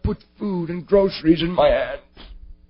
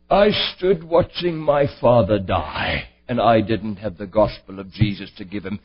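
grave father; 10 semitones lower than usual. Retold or imitated. Father telling his son about his own father's death, low and grave. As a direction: voice a solemn grieving father.

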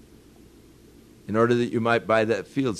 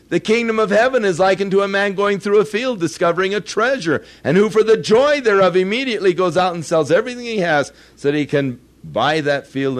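raised, ringing recital of the parable, higher and louder; scripture recital; 8 semitones higher, 7 dB louder than usual. Acted. Preacher reciting the parable to the congregation, ringing recital. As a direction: proclaim the verse like from the pulpit.

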